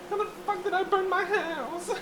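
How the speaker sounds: very high squealed wail; high-pitched, hammed-up distress; mock panic. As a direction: squeal it in comic despair. Panics comically over burning smell.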